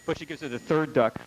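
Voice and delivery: usual speaking voice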